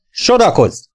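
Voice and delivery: sharp high command to line up; barked order. A narrator's reading. Instructor orders the boys to line up, barking. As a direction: bark it across the pool hall. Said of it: "csattant föl ismét".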